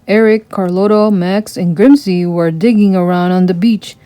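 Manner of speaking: ordinary delivery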